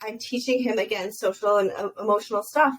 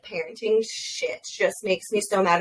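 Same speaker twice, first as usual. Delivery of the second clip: ordinary delivery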